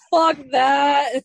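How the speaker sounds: high, loud mock outburst through laughter; mock defiance. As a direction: blurt it out laughing, as playful defiance. Acts out friend's defiance while laughing.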